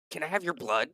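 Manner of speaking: lightly voiced eccentric asking a favour; curious request. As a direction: ask it with keen, odd curiosity. Scientist eagerly asks green visitor.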